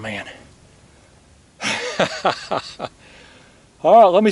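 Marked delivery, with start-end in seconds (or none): 1.6-2.9 real run of giggles, higher and soft; quick, soft, high chuckle; delighted laugh. Real, not acted. Angler delighted with his big catch, giggling laugh.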